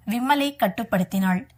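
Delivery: ordinary delivery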